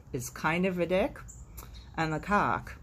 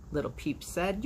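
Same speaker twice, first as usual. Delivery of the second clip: plain reading voice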